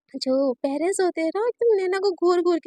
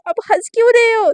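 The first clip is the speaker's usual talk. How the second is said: high, much louder, irritated; annoyed complaint; 6 semitones higher, 9 dB louder than usual. Acted. Naina scolding her laughing parents, annoyed. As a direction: snap at them for laughing.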